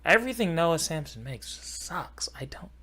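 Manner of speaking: a quick whisper of an insult; a real whispered dig; whispered aside. Real, not acted. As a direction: breathe the jab out unvoiced.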